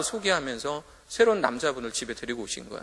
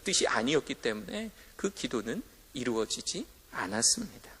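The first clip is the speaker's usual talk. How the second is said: hushed whisper; 4 semitones lower, 8 dB quieter than usual. Acted. 설교자가 회중에게 결론을 속삭이듯. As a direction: whisper the conclusion, unvoiced. Sermon point, hushed delivery.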